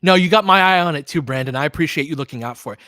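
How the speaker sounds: usual speaking voice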